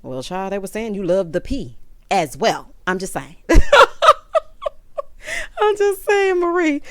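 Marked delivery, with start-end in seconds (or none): 3.4-5.5 loud squealing laugh; real shrieking laugh at her own joke; big high laugh. Real, not acted. 5.6-6.8 high, loud laughing words; giggling I'm just saying. Real, not acted.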